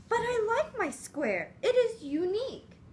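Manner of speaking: high, proud little voice; small spider voice. Acted. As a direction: squeak it out proudly. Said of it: "Weaver said".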